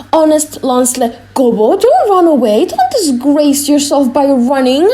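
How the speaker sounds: loud, high anxious warning; pleading conscience. Acted. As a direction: urgently hold him back. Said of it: "or, as I said before".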